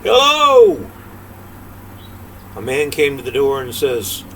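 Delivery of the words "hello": high, loud holler from the gate; a boy hollering up at a big house. Acted. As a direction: call out to the house. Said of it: "hollered out".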